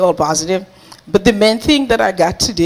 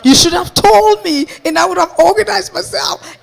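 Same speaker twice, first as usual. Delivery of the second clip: high, loud protest; retold panic; 8 semitones higher, 4 dB louder than usual. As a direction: scold a friend in rising panic. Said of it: "And I was panicking".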